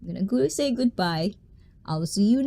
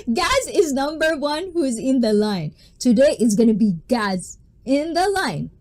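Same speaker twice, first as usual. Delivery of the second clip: ordinary delivery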